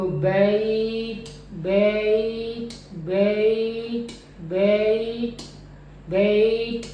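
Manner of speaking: बेट held very slow on the vowel; drawn-out बेट. Shown as a demonstration. Teacher stretching the diphthong for students, very slow. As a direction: stretch बेट far out, slowly. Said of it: "अगर मैं इसको लंबा करूँ".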